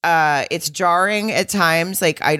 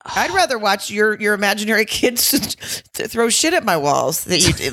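usual speaking voice

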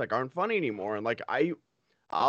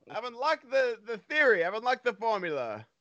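high-pitched eureka moment, hammed up; mock triumph; 7 semitones higher, slower than usual. Imagined guy announcing his winning formula to the boys, mock triumph. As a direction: play a man who thinks he cracked it. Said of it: "He's like".

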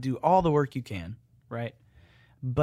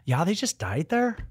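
high, loud Irish lilt; Irish accent; 8 semitones higher, 6 dB louder than usual. Acted. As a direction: report the sad news in melodic Irish. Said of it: "Irish accents go up".

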